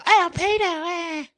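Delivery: very high, loud put-on squeaky voice; mock squeal. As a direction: squeal the word in a silly high pitch. Silly mocking repeat of the word.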